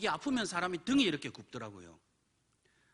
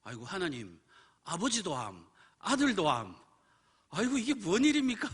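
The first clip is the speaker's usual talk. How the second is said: acting out a grumbling outburst; exasperated complaint; 3 semitones higher, 4 dB louder than usual. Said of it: "불평이 튀어나왔어요".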